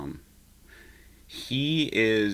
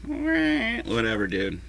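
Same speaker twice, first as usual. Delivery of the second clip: mock dismissive; 12 semitones higher, much slower than usual. Mock dismissal of friend's leads.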